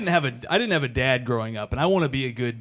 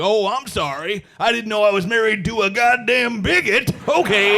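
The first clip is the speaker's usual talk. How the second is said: higher, louder, sarcastic fake offense; mock indignant; 7 semitones higher, 4 dB louder than usual. Husband to his wife over the beer, high sarcastic fake outrage. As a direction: play the wounded victim, sarcastically. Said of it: "I could be like".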